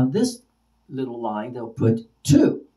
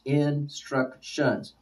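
"instructions" very slow, in three separate beats; syllable by syllable; 6 dB quieter, much slower than usual. Shown as a demonstration. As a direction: break "instructions" into in, struc, tions, softly. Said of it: "three syllables".

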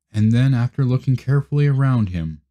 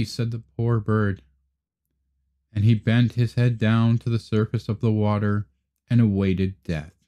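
usual speaking voice

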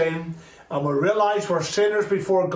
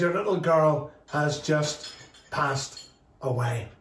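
slow, low, subdued retelling; gently breaking bad news; 5 semitones lower, 4 dB quieter than usual. Messenger telling a father his daughter died, slow and subdued. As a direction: let it sink slowly, word by word.

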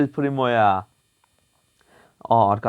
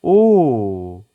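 loud surprised oh; 8 dB louder than usual. Real, not acted. Gameplay surprise.

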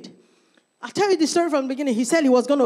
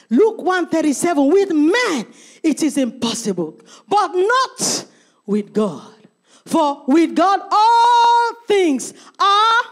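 scripture proclaimed; 4 semitones higher, 5 dB louder, slower than usual. Acted. Scripture read, building.

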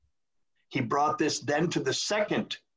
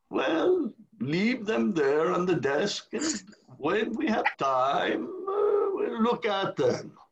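high, slow, offhand brush-off voice; dismissive pope; 7 semitones higher, slower than usual. Retold or imitated. The pope brushing off Gagnon's reform volumes, high and unhurried. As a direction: wave the papers away lazily.

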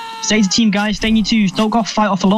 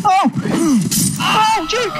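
dismayed cry; 13 semitones higher, much slower than usual. Real, not acted. Teammate dies.

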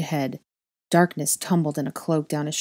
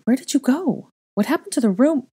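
high, louder breathless questions; 9 semitones higher, 5 dB louder than usual. A narrator's reading. Relieved girl questioning Draco about the room, breathless. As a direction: ask urgently, pitched up. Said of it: "I said breathlessly".

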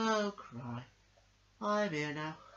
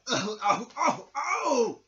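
mock yelps of pain; 7 semitones higher, 10 dB louder, much slower than usual. Toy bounces and hurts itself.

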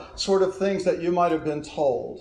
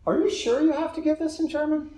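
high-pitched, tentative student; tactful doubt; 10 semitones higher than usual. Retold or imitated. Student hinting to her professor about bad German, tactful doubt. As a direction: gently question it, pitched well above normal.

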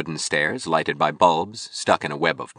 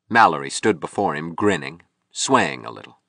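plain reading voice

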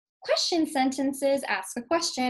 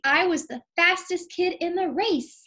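higher and louder, bursting with pride; excited exclamation; 6 semitones higher, 4 dB louder than usual. Shown as a demonstration. Teacher modeling an exclamatory sentence for students, excited. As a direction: read it like you just won the race. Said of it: "I know to say it with an excitement".

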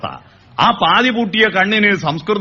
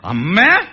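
അമ്മേ rising at the end; child's rising അമ്മേ; changed calling tune; 3 semitones higher, slower than usual. Shown as a demonstration. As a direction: lift the end of അമ്മേ like a questioning call. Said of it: "മാറി മാറി വരുന്നില്ലേ".

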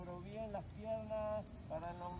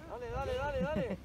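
loud urging; 3 semitones higher, 6 dB louder, faster than usual. Real, not acted. Coach urging through exercise set.

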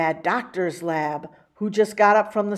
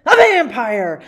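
excited reveal; 4 semitones higher, 10 dB louder than usual. A narrator's reading. Reveal of vampire in story.

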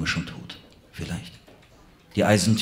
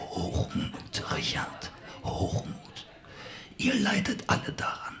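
weak whispering villain; 8 dB quieter, slower than usual. Acted. Dying villain whispers slowly.

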